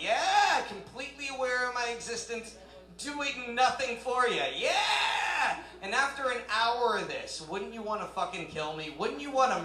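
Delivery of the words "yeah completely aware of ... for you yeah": high, hammed-up cheering; sarcastic high-pitched enthusiasm; mock cocky lover. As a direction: exult like an oblivious show-off. Acts out clueless lover.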